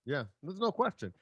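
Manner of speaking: normal talking voice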